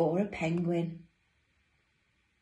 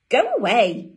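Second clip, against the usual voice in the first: loud, higher storybook shooing; annoyed penguin; 4 semitones higher, 11 dB louder than usual. Acted. Dudley to his brothers and sisters, loud annoyance. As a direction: send them off, loud and cross.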